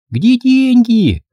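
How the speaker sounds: put-on high, drawn-out girlish demand; child playing the nagging wife; ringing little-girl voice. Girl playing wife demands money, high ringing child voice. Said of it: "звенел девчоночий голосок".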